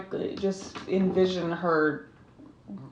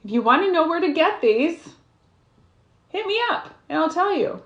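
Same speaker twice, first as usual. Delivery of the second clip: bright sales pitch; 9 semitones higher, 6 dB louder than usual. Real, not acted. Cheerful plug for her crafts.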